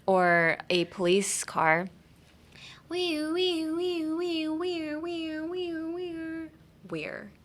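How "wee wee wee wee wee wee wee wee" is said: high wailing siren imitation; high, looping wee-wee siren; ambulance siren. Shown as a demonstration. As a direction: wail up and down like a police car. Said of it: "pretend you're an ambulance".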